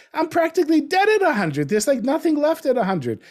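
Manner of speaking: a mock-incredulous high voice; mock dismay. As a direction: play up the absurdity of living to a hundred. Exaggerating old age.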